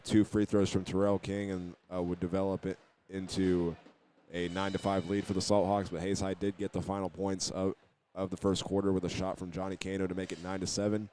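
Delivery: normal talking voice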